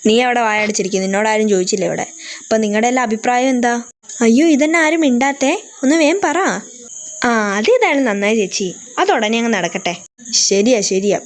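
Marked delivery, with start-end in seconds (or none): 4.2-6.4 impatient. Acted. Impatient urging of relatives.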